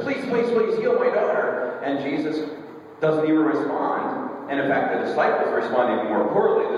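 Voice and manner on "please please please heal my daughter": pleading mother. Retold or imitated. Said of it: "she says".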